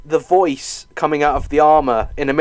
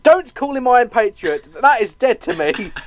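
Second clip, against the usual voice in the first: higher-pitched, playful outrage; mock indignation; 7 semitones higher than usual. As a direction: object as if personally offended. Mock outrage over a name.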